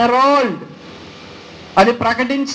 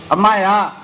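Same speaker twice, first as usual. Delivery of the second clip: mocking retort; 5 semitones lower than usual.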